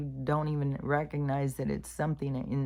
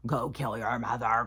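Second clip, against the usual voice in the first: voice in his head; 3 semitones lower than usual. Retold or imitated. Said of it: "how the voice is telling you".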